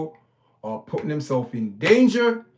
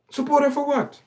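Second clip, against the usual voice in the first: much higher, a disbelieving repeat of "Support her for what?"; incredulous; 11 semitones higher than usual. Real, not acted. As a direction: throw the question up high, can't believe it.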